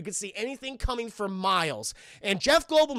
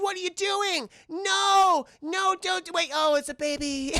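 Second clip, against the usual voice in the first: high-pitched, hammed-up protest; cartoonish high "no, no" panic; mock alarm; 8 semitones higher, 3 dB louder than usual. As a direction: imitate an alarmed girlfriend objecting. Acting out girlfriend's alarm at rescue.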